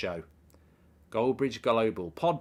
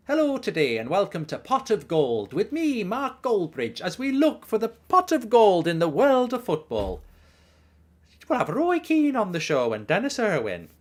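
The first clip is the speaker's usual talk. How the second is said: hammed-up Irish accent, higher and louder; 9 semitones higher, 6 dB louder than usual. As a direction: play a cheesy Irish TV host. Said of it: "That's meant to be an Irish accent".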